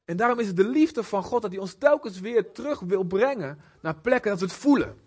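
normal talking voice

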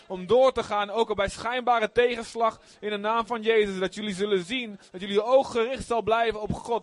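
driving, continuous proclamation in prayer; fervent blessing prayer. Real, not acted. Preacher blessing two youth leaders, fervent prayer. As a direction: pour out blessing, phrase after phrase.

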